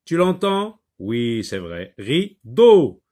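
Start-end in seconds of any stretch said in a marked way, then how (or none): 2.0-3.0 Rideau with the second syllable deau pushed louder; teacher stressing deau in Rideau; stressed second syllable. Shown as a demonstration. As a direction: lean hard on the deau of Rideau. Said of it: "il se trouve dans la deuxième syllabe".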